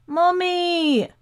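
long high-pitched wail for mother; high, panicked cry; child's scream. A narrator's reading. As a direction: scream out like a terrified small boy. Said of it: "again there was the scream".

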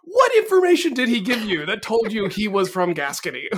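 incredulous outburst on the edge of laughter; mock incredulous. Mock outrage at a film line.

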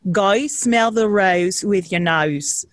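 slow Australian accent demo. Shown as a demonstration. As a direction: stretch "rose" and "nose" into Australian vowels, like "roys" and "noys". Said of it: "how I would get into it".

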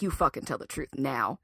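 cornering the sister privately; 1 hissed demand. Retold or imitated. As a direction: threaten under your breath. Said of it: "I was like".

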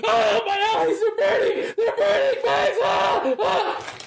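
high, shrill-free panicked shouting, eyes burning; fake pain screaming. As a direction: scream as if eyes are on fire. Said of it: "Just kidding".

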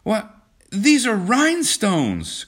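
slow, very high, loud disbelief; acted high-pitched outrage at a discovery; shocked cry. A narrator's reading. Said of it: "cried John".

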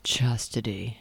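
low, soft, whispery male-character voice; low rumble, no accent. Shown as a demonstration. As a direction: drop it to a rumbling near-whisper. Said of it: "with not a Scottish accent".

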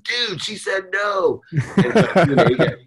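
mock yell. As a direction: drag it out high, teasing. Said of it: "he yells out in front of everyone".

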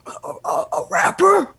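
stammered disbelief, leaping high on RAPPER; Vince. Retold or imitated. Wrestling boss hearing who wants to join, incredulous stammer. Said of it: "That's my best Vince imitation".

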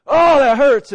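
high, loud mock pained cry; toe in the tub, hammed-up ouch. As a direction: act out the sting of hot water. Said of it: "you're like".

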